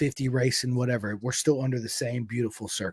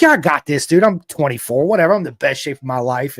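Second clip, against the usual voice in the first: brash and loud, a little higher; cocky; 3 semitones higher, 9 dB louder than usual. Retold or imitated. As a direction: overconfident young rider bragging. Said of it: "And you think".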